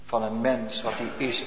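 ordinary talk

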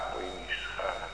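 soft echo. Real, not acted. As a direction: let the words fall to a hush.

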